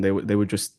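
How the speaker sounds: normal talking voice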